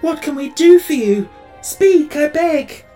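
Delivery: high, loud tearful pleading; frantic entreaty. A narrator's reading. Tin Woodman begs suffering Tip to speak, frantic entreaty. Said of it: "entreated the Tin Woodman".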